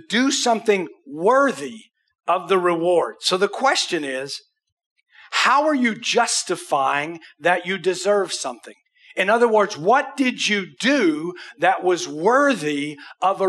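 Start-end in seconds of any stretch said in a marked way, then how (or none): none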